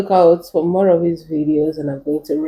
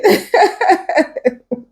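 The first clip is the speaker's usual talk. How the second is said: high giggling laugh; amused laughter; 11 semitones higher than usual. Real, not acted. Laughing at own remark.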